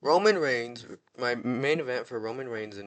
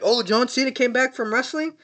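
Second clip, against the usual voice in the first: high, loud fake amazement, then a flat psych reveal; mock fan surprise; 9 semitones higher, 4 dB louder than usual. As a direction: gasp at the comeback, then drop it. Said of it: "people to be like".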